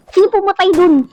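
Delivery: ordinary talk, nothing marked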